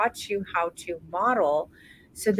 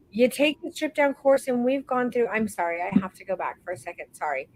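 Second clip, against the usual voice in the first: ordinary delivery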